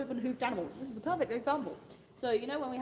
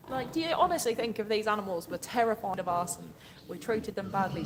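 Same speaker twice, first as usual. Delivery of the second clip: usual speaking voice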